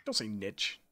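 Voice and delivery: "niche" said as NITCH, low and soft; "niche" with short i and a ch ending; American mispronunciation quoted. Retold or imitated. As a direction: say "niche" to rhyme with pitch. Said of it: "Americans who say niche".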